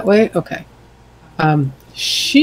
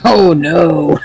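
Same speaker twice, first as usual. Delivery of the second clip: louder, drawn-out "oh no" of dismay; mock dismay; 5 dB louder than usual. Player reacting to the game master's bad news, mock dismay. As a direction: play up the dread a little. Player's character hit by enemy's spell.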